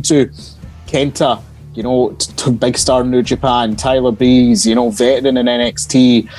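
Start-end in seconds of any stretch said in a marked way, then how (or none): none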